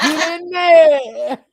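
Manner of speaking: laughing shout, slow; laughing cheer. Real, not acted. Egging a friend on, laughing shout.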